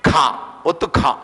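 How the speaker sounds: "ఖ" with a puff of breath; aspirated kha. Shown as a demonstration. Teacher demonstrates mahaprana sounds, aspirated "ఖ". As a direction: press out a heavily aspirated k on "ఖ". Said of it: "మహాప్రాణంగా ఎట్లా పలుకుతాం?".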